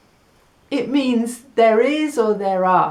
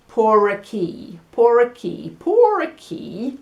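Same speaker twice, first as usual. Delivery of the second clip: por aquí with a heavy English accent, the r dropped into a long pour; 3 dB louder than usual. Shown as a demonstration. Teacher mocks how an English speaker says it. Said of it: "If we're saying it with the English accent".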